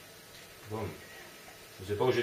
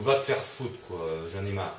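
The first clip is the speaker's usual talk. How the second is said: normal talking voice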